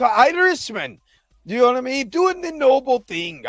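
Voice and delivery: attempted Irish accent, pitched high; hammed-up, high Irish lilt; bad Irish accent. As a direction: put on a terrible, high-pitched Irish brogue. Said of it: "I can't do an Irish accent".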